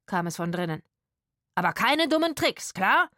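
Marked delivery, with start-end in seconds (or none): 1.6-3.1 raised, sharp warning with questioning end. A narrator's reading. Rufus to the actors inside, warning. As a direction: call out a stern caution. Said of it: "warnte Rufus".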